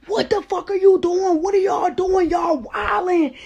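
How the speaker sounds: high, loud, incredulous outburst; alarmed shouting. Retold or imitated. Man walking in on a fight, alarmed shouting. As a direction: burst in demanding answers, pitch way up. Newcomer bursts in on retold fight.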